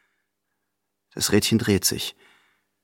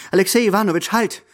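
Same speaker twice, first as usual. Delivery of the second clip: high, louder call after him; calling back; 9 semitones higher, 4 dB louder than usual. Acted. Grandmother calling Alexei Iwanowitsch back, urgently. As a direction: stop him before he's out the door.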